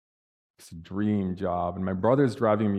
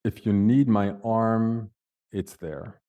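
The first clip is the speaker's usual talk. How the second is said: slow, weighty, tender repeat; heartfelt and unhurried; sincere offer; slower than usual. Retold or imitated.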